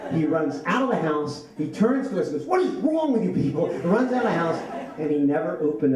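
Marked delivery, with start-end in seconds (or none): 2.5-3.7 high-pitched, hammed-up cry of outrage; freaked-out outburst. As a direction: act out a freaked-out friend, voice leaping up. Spooked friend fleeing after basement scare.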